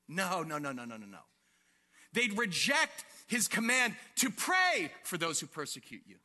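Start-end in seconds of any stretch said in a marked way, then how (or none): 0.1-1.2 soft, falling string of refusals; dismissive refusal. Acted. Preacher voices crowd rejecting love of enemies, dismissive. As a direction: wave it away, pitch sinking.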